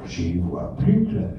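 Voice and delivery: softly muttered repeated phrase; muttering. A narrator's reading. Old Littré sprawled on his sofa, muttering. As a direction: mutter it low-key, as if to oneself. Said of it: "en marmonnant".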